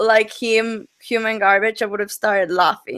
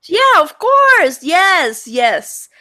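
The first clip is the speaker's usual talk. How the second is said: emphatic reassurance; 6 semitones higher, 4 dB louder, much slower than usual. Real, not acted. Guest asks about swearing.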